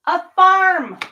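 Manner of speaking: exasperated disbelief. Real, not acted.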